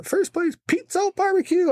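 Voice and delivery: high, ringing announcer voice; announcer call. Retold or imitated. Announcer naming the first place winner, high and ringing. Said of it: "And then, uh".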